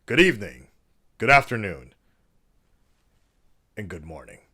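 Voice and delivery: loud, formal, announcer-style address voice; mock presidential address. Vlogger parodying a presidential speech to viewers, grand and formal. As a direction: play a head of state addressing the nation. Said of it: "I don't know why I keep doing this accent".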